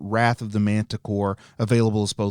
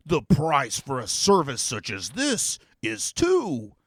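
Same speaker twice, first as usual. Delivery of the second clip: demon voice. Acted. Demon to the heroes, pronouncing a dramatic prophecy. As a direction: intone it like a grand, theatrical demon.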